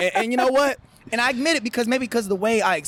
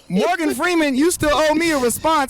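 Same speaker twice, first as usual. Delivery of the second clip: playful raised-voice complaint at a celebrity; mock indignant call-out; 5 semitones higher than usual. Artist calling out Morgan Freeman over an unanswered DM, mock indignant. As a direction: scold a star who ignored you, half joking.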